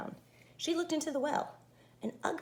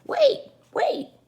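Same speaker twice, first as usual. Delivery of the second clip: high, loud calling "Wait, wait"; frog crying out; 12 dB louder than usual. Acted. Frog calls after the fleeing princess, high loud cry. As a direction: shout after her in a squeaky frog voice. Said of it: "cried the frog".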